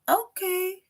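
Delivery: very high, unsure "Okay"; awkward okay. Pretend. Teacher miming an awkward reply, high and unsure.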